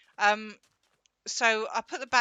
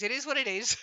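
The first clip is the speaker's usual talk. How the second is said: Dad's catchphrase; 3 semitones higher, 4 dB louder than usual. Retold or imitated. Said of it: "it's Dad's favourite phrase".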